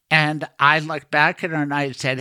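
ordinary delivery

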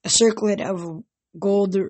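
ordinary delivery

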